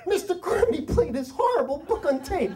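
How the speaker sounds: put-on very high, loud kid's tattling cry; imitated wailing child. Boy runs to his parents, imitated high wail.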